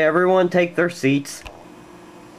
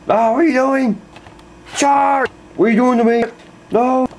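panicked cries; 8 semitones higher than usual. Acted. Teacher crying out as the class charges him, panicked. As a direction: cry out as they charge you.

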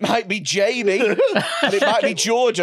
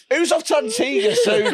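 mock tout; 8 semitones higher than usual. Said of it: "going down Blackpool seafront going".

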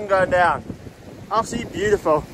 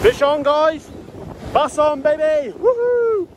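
high, thrilled fish-on whoop; excited cheer; 7 semitones higher, much slower than usual. Real, not acted. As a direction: whoop with joy at the catch. Hooks a bass, cheering.

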